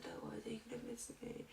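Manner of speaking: high, hushed, near-whispered reassurance; imitated comforting. Teacher consoling crying pupils, whispery retold comfort.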